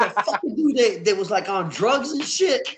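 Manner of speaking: high, loud, animated voice; excited recounting. Retold or imitated. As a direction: keep it high and loud for the kids. Continues excitedly telling his kids.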